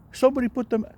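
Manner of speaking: high, loud flustered outburst; nervous blurt. Retold or imitated. Flustered Little Dick blurting to the brothers about the cover. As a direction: sputter it out, all red in the face. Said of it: "He's all nervous".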